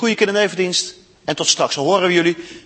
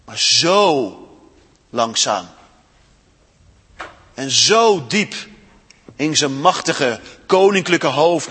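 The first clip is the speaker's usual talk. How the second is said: expressive read-aloud storytelling; story reading. A narrator's reading. Storyteller reading Narnia to children in church, expressive.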